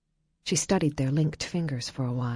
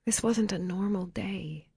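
gentle; 4 semitones higher, 5 dB quieter than usual. A narrator's reading. Comforting her after crying.

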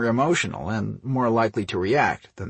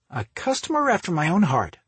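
high-pitched, pleased reply; cheerful; 9 semitones higher, faster than usual. A narrator's reading. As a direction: lift the voice in playful delight. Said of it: "Dorothy cheerfully replied".

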